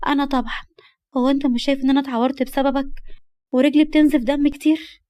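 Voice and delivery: put-on upset young girl. (بصوت بنت زعلانة ومعاتبة)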